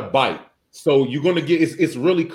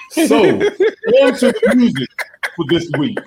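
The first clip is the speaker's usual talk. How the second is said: laughing; 5 semitones higher, 4 dB louder than usual. Real, not acted. Laughing through a segue.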